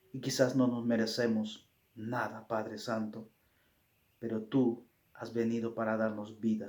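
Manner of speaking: soft, humble closing prayer. Acted. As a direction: pray quietly to the Father.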